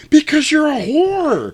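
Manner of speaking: very high, loud and slow falsetto jab; hammed-up girly voice snapping at the screen; mock shrill retort. Yelling at screen while watching.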